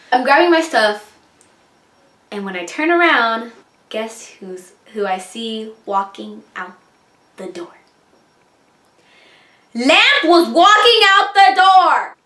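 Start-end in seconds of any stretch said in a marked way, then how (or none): 2.3-7.8 very soft, halting, ominous build-up; hushed suspense. Real, not acted. Storyteller setting up who followed her out, hushed suspense. 9.7-12.2 slow, high, loud cry of disbelief; outraged shouted reveal. Real, not acted. Storyteller revealing he followed her out, high disbelieving shout.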